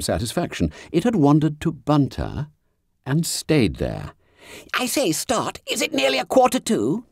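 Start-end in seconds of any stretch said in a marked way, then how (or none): 4.7-7.0 high, hushed schoolboy whisper; squeaky fat boy whispering in class; Bunter's whisper. A narrator's reading. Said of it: "whispered Bunter".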